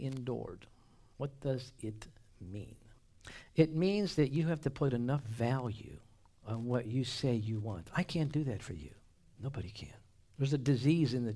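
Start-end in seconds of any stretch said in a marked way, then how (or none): 1.2-2.8 hushed whispered question. Real, not acted. Preacher whispering to the congregation, hushed. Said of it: "whispered rhetorical question". 9.4-9.9 low confiding whisper. Real, not acted. Preacher whispering a warning to the church, hushed. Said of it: "whispered warning".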